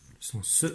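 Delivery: "Le son S" whispered, hissing the S; hissed S sound. Shown as a demonstration. Teacher isolating the S sound, whispered. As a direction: breathe out the S softly. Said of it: "j'entends d'abord le son S".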